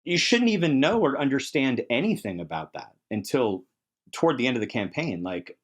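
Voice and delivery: ordinary delivery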